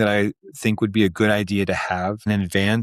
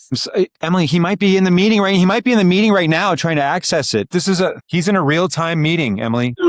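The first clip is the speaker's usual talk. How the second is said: urgent; 8 semitones higher, 4 dB louder than usual. Real, not acted. Urgent interruption, colleague stuck.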